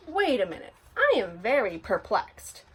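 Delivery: plain reading voice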